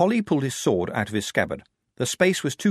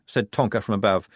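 ordinary delivery

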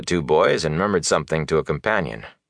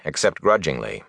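usual speaking voice

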